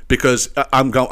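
usual speaking voice

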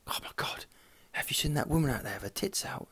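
hushed, voiceless gossip; mock girlfriend whisper. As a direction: whisper it like a girlfriend nudging him. Voicing his girlfriend.

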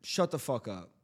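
low, soft, casual put-down; understated hypothetical shut-down; changed tone. Pretend. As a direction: drop the pitch and volume to test the tone. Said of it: "the tone, the tone".

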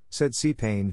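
plain reading voice

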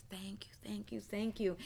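soft unvoiced grateful whisper; whispered thanks. Preacher retelling the waitress thanking her, whispering through tears. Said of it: "and she said".

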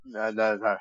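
ordinary talk

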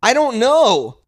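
high, loud comic exasperation; exasperated outburst. Real, not acted. Host flustered by co-host's question about minutes, exasperated outburst. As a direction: protest loudly, pitch jumping up. Exasperated over a time guess.

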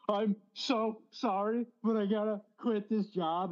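high, slow teary plea; mock-crying apology to the boss; tearful apology. Young employee quitting in tears to his boss, high teary voice.

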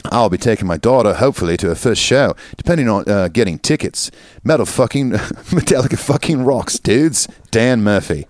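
hammy Aussie letter-reading; playful put-on Australian; Australian accent. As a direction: read it as an Australian, close to laughing. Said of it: "my Australian accent".

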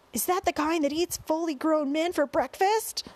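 high-pitched, alarmed asking; frightened question. A narrator's reading. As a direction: ask it with the voice pushed up in fear.

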